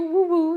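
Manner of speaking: quick double whoop for your school; celebratory whoop. Real, not acted. Cheering for HBCUs.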